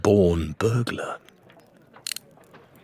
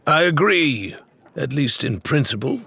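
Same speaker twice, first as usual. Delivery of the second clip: stated to the room; 7 semitones higher than usual. A narrator's reading. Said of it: "he stated to the room at large".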